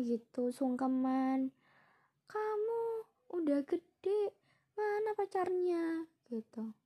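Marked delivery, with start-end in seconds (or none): 0.5-6.1 high, drawn-out wail imitating grandma; fussing grandmother. Grandmother demanding to know where the boyfriend is, high imitated fussing. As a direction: wail it slowly in mock exasperation. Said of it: "yang nenek aku ini yang heboh gitu".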